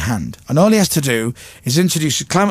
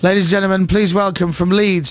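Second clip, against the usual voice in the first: higher-pitched festival MC intro; stage announcer; 7 semitones higher than usual. Retold or imitated. Colin to the Leeds Festival crowd, announcing. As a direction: imitate a host announcing a band. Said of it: "Colin goes".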